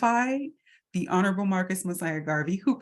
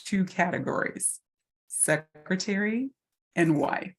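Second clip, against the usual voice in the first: usual speaking voice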